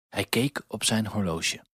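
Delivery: usual speaking voice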